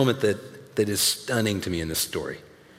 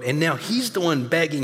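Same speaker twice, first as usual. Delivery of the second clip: ordinary talk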